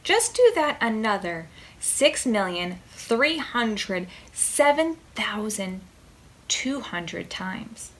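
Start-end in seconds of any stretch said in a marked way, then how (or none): none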